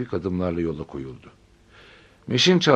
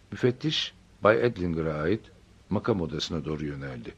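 ordinary delivery, nothing marked

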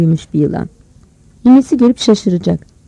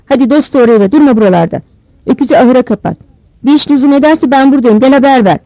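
raised-voice command; bossy orders; 4 semitones higher, 4 dB louder than usual. A narrator's reading. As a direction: send the boy off with firm orders. Grandmother orders boy home.

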